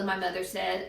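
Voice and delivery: ordinary delivery, nothing marked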